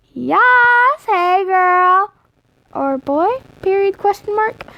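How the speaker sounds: put-on high, loud, sing-song hey girl; sassy greeting. Vlogger greeting her viewers, loud sassy squeal. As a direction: greet them like a bestie, over the top.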